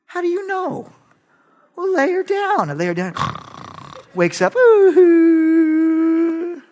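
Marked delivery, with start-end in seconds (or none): 0.0-2.7 imitating wife. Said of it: "Christy would come home". 4.5-6.6 mock baby whimper. Baby crying in story.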